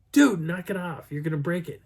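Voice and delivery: mildly exasperated protest; retold exasperation. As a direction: tell a buddy to knock it off. Retold exasperated telling-off of friend.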